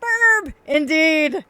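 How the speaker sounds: high, loud cartoon bird squawk; origami bird voice. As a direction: exclaim it as an excited little birdie. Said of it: "That's the voice I give all the origami birds".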